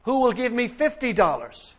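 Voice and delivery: loud, high auction call to the room; auctioneer's call. Retold or imitated. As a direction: sell it to the back row. Auctioneer lowering the bid.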